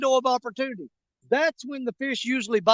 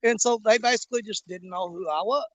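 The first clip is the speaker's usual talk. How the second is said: ordinary talk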